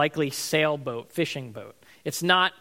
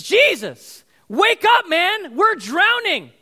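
high, loud acted shout of alarm; desperate, full-voiced accusing cry; panicked yelling; 13 semitones higher, 9 dB louder, slower than usual. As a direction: yell at a sleeper while the boat goes down. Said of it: "This is a guy holding a bucket, shoves it in his face".

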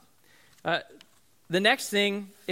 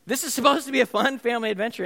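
higher, louder, fast frustrated rant; a dad venting about a failed outing; 8 semitones higher, 6 dB louder than usual. Retold or imitated. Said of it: "I was so frustrated".

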